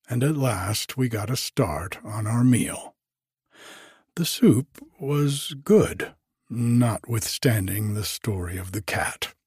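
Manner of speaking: ordinary delivery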